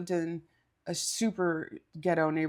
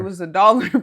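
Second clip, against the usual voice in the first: laughing; 13 dB louder, faster than usual. Real, not acted. Laughing at the absurd robbery.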